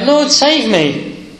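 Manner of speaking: high, drawn-out plea for rescue; crying out. A narrator's reading. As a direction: cry out as one sinking, without shouting. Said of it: "cried out".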